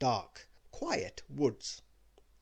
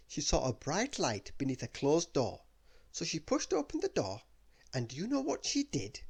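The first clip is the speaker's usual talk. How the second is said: usual speaking voice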